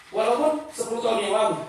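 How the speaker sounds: usual speaking voice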